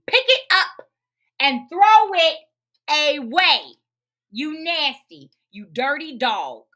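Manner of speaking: loud, punched-out angry scolding; getting mad. Real, not acted. Daughter fed up with dad's mess, angry loud scolding. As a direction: pound out each phrase, real irritation. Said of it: "I'm getting mad, Spirits, as I talk".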